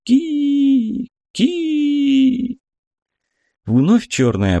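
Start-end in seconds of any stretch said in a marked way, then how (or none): 0.0-2.6 high piercing bird cry. A narrator's reading. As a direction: shriek it like a hawk. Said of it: "смех, похожий на крик".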